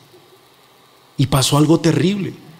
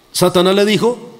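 ordinary talk, nothing marked

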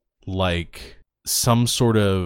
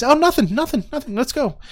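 much higher, flustered brush-off; flustered girl voice; 3 dB louder than usual. Acted. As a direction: squeak it out, covering up. Flustered fan brushing friend off.

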